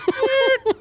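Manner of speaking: high-pitched mechanical whine; imitated whiny noise. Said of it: "a weird whiny noise".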